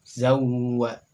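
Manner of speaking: Zawwa, ww held then released; loud model of Zawwa; doubled w. Shown as a demonstration. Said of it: "ia disukunkan terlebih dahulu".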